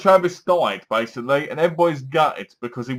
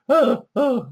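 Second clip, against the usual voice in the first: mock cries; 10 semitones higher than usual. Killer in wig, funny scene.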